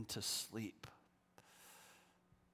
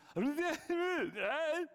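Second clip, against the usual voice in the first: laugh; 14 semitones higher, 5 dB louder than usual. Real, not acted. Laughing at his own reaction.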